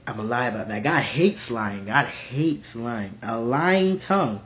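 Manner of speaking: normal talking voice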